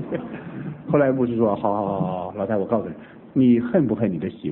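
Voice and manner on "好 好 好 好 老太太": ordinary delivery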